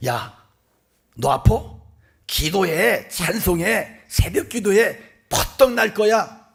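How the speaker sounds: mock a pushy believer, building to a shout; brusque exhorting. Mimics brusque advice to the sick.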